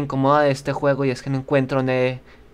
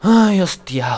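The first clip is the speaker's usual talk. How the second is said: high, loud startled yelp; startled cry; 6 semitones higher, 5 dB louder, much slower than usual. Real, not acted. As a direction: yelp in fright. Startled in game.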